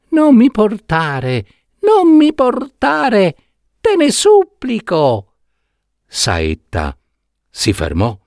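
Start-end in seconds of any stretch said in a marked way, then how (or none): 0.1-5.3 tiny voice, much higher, begging; thin little pleading voice. Acted. Trapped prince begging Saetta not to deliver him, tiny thin pleading voice. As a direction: plead from inside the box, small and thin. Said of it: "una vocina sottile, sottile, che pregava".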